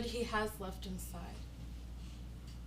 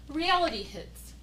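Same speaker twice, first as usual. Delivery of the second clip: normal talking voice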